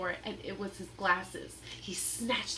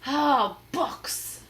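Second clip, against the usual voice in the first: pirate disdain; 5 semitones higher, 6 dB louder than usual. Acted. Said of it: "said Captain Bones".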